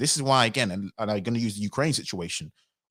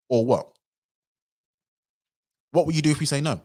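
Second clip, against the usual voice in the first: higher, louder defiant challenge; mock royal defiance; 5 semitones higher, 3 dB louder than usual. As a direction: taunt them: what would you do. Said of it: "what I would say if I was".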